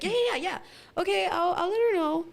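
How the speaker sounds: high, hurried agreement; placating. Retold or imitated. Niece getting her aunt off the phone, placating. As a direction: humor the caller in a high voice.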